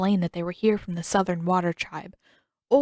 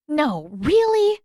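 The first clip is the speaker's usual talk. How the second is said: slow, higher, drawn-out sarcasm; sarcastic; 8 semitones higher, 4 dB louder, slower than usual. A narrator's reading. Katara mocks her brother, thick with sarcasm. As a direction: drip with sarcasm. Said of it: "voice thick with sarcasm".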